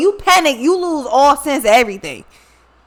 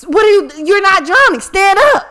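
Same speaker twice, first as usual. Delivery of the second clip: high, loud, disbelieving shout; exasperated yell; 7 semitones higher, 5 dB louder than usual. Retold or imitated. Yelling at the brother in the six-foot pool, high and loud. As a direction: yell at him to just stand up.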